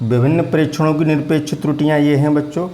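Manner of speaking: ordinary talk, nothing marked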